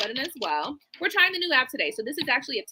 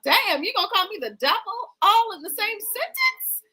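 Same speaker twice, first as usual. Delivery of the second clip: mock shocked reply; 7 semitones higher than usual. Acted shocked reply to accusation.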